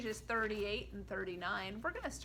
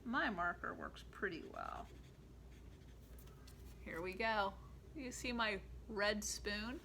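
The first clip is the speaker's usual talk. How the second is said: ordinary talk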